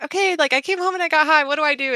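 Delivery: high, frantic retold plea; mock panic on the phone. Retold frantic call to clinic.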